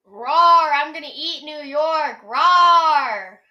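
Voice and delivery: roaring dinosaur, very loud. Pretend. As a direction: roar like a monster stomping a city. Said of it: "recreate the sound of a dinosaur trampling".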